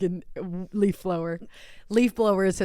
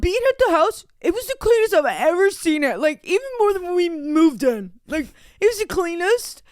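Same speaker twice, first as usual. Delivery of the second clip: high, loud mocking whine; partner impression; 11 semitones higher, 6 dB louder than usual. Mocking partner praising the other house, high whine. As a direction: whine it in an exaggerated squawky voice.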